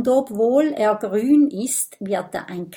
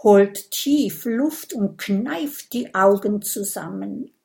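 ordinary delivery, nothing marked

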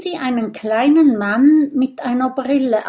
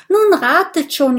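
high, loud impatient prod; impatient urging; 8 semitones higher, 6 dB louder than usual. A narrator's reading. Porcupine pushing lazy friends to guess, impatiently urging. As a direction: urge them on, pitched up high.

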